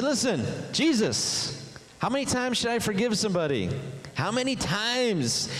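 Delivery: high-pitched, insistent questioning; Peter. Acted. Disciple asks Jesus about forgiving, high and insistent.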